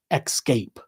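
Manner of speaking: "Escape" said as "ekscape", with an X sound; mock the host's "ekscape"; mispronounced escape. Radio show host's mispronunciation, imitated lightly. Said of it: "always says".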